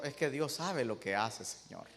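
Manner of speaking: a quiet, humble servant answers his king gently; humble servant, hushed. Acted. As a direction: speak softly, like a meek servant. (siervo humilde, voz baja y serena)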